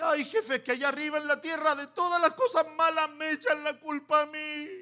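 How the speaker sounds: high whiny imp voice; little demon whining. Retold or imitated. Little demon complaining to the devil, whiny. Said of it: "un demonio chiquito".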